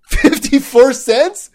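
slow, high, laughing through it; laughing disbelief. Retold or imitated. Realizing the savings is tiny, slow laughing disbelief. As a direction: laugh out the number in amazement.

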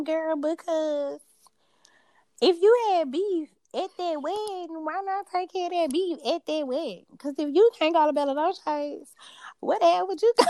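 high, put-on voice, indignant questioning; spot-on video impression. Co-host quotes the woman from the wedding beef video, high-pitched impression. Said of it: "so weird how you sound just like her".

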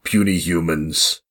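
low, slow monster growl; lumbering brute voice; Hulk voice. Acted. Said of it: "someone who smashes".

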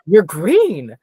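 mock squeal, swooping up high; imitated startled scream. Imitating the actress's turnaround and scream, mock shriek. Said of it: "little turnaround and scream, and she goes".

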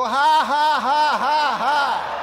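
loud, high, deliberate "ha" beats; forced laugh. Shown as a demonstration. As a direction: force out big separate ha-has. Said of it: "Even when you don't feel like laughing".

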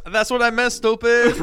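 mock indignant retort. Customer answering the cashier, mock indignant. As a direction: snap back in mock outrage.

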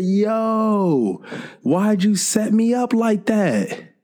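high, drawn-out mock complaint; aggrieved protest. Retelling protest to sister after setup.

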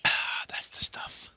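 slow satisfied whisper. Real, not acted. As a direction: breathe it out after a sip. First sip of beer.

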